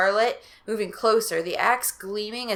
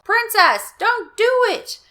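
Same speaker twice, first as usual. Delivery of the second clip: ordinary delivery, nothing marked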